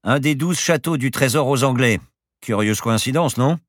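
insistent professor dropping a hint. A narrator's reading. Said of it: "Bergton insista".

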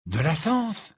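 high, rising exclamation; sudden realisation. A narrator's reading. Ron struck by a sudden idea, excited. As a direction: burst out as the idea strikes. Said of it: "répéta soudain Ron".

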